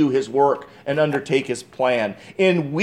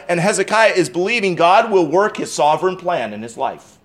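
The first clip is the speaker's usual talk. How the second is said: ordinary talk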